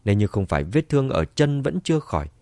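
ordinary delivery

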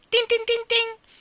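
high, ringing tin-tin; imitated bell. A narrator's reading. Ice-cream man rings his bell, imitated jingle. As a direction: sing out like a little bell, high and loud.

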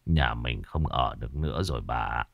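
low, soft, worn-out voice; tired old husband. Acted. Husband telling wife they must leave, low and weary. Husband speaks weakly in bed.

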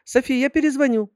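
put-on high chirping girly voice; cartoon princess. A narrator's reading. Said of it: "прощебетала она".

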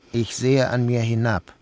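plain reading voice

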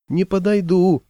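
high, plaintive refusal; acted dreary cry across water; mournful distant call. A narrator's reading. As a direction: wail it from far off. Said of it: "тоскливо донеслось с невидимого Жучка".

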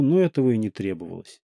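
plain reading voice

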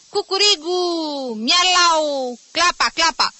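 high, drawn-out animal calls; riotous mimicked cries; class shouting. A narrator's reading. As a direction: crow and yowl like rowdy schoolboys. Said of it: "clasa striga".

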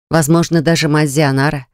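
usual speaking voice

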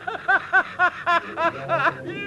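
high mocking cackle; mocking laugh. Animal friend laughing at the fishermen's misery. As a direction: laugh at them openly. Friend mocks their ordeal.

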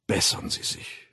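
hollow, dread-laden murmur; sepulchral voice. A narrator's reading. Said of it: "Jetzt sprach er mit Grabesstimme".

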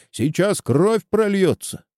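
high-pitched threat; yelling. A narrator's reading. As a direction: warn of bloodshed in a lifted voice. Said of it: "орёт Чеба из-за бука". (крик с угрозой)

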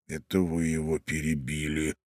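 low, quiet reproach; muttered with teeth clenched; through gritted teeth. A narrator's reading. Young man blaming Ivan for the interruption, teeth gritted. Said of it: "опять заскрежетав зубами".

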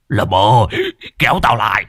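loud desperate shout; panicked yell. Acted. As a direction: scream for help. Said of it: "Lão gào lên". (gào to hoảng loạn)

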